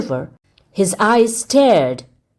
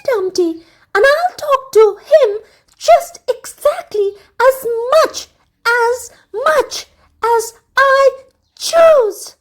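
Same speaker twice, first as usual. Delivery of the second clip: much higher, louder, crying but stubborn; tearful defiance; 5 dB louder than usual. A narrator's reading. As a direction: weep and insist at once. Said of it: "sobbed the princess".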